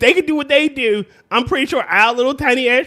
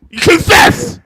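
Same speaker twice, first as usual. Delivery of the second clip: loud, high mock demand; mock interrogation; 9 semitones higher, 6 dB louder, slower than usual. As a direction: play an interrogator forcing a confession. Acts out an interrogation.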